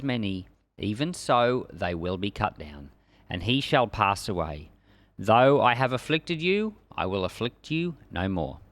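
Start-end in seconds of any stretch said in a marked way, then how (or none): none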